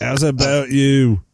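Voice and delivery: slow fake accent drifting toward Irish; attempted English accent, landing near Australian; botched British accent. Joining the mock British banter, accent slipping into Irish. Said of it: "that's Australian ... that's Irish".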